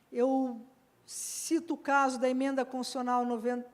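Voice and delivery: usual speaking voice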